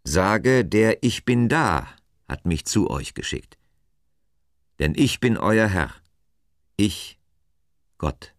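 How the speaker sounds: lightly voiced God, emphatic and deliberate; measured proclamation with weighty pauses; God, proclaiming. A narrator's reading. God gives Moses his message, solemnly proclaiming.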